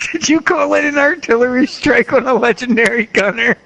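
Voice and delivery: high-pitched, cracking up while talking; laughing. Real, not acted. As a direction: squeal it out through helpless laughter. Cracking up at game event.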